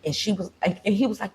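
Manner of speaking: ordinary talk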